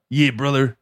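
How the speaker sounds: Hulk Hogan voice. Retold or imitated. Hulk Hogan shirt rip impression.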